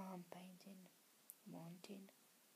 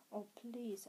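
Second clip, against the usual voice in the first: loud, understated narrated plea; a touch of urgent begging; inward begging; 2 semitones higher, 9 dB louder than usual. A narrator's reading. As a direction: plead with a burst of volume. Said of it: "I beg internally".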